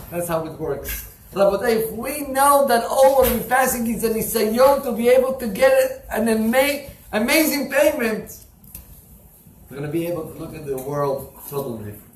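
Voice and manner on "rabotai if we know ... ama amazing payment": high, loud sermon build; fervent preaching. Acted. Rabbi preaching to the congregation, fervent and loud. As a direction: drive the point home to the crowd.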